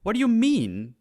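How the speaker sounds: high, loud indignant protest; high-pitched mock disbelief; mock outrage. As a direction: squeak out the injustice, hammed up. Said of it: "And I'm just like".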